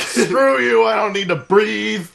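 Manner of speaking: riffing, shouting the colonel's bravado; mock colonel yell. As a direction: bellow it like an unkillable meathead. Colonel refusing mask, joking.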